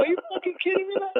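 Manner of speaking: high-pitched disbelief, not shouted; retold incredulity. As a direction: re-enact being stunned by the excuse. Repeats his retold disbelief.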